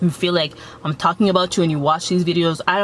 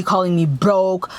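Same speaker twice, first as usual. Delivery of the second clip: ordinary talk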